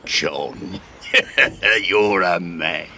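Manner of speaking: loud laugh into slow praise; gleeful admiration. Acted. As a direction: cackle with approval at your ringleader. Crewman delighted by the plan.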